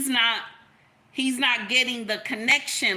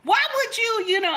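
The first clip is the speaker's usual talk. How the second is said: high, laughing indignant sputter; mock outrage; 11 semitones higher, 4 dB louder than usual. Mother retelling confronting the coach, high laughing outrage. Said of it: "I was mad with the coach".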